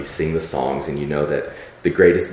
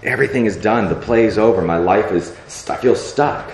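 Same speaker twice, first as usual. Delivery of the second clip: higher, louder hopeless protest; despairing outcry; 5 semitones higher, 5 dB louder than usual. Retold or imitated. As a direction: cry out to God that it's all over. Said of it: "I say to him".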